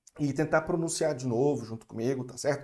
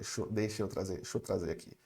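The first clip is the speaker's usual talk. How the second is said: much softer, muttered repeat; quiet self-quote; 7 semitones lower, 8 dB quieter, faster than usual. Retold or imitated. Teacher quoting what he muttered a moment ago, softly. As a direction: say it under your breath. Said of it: "eu falei".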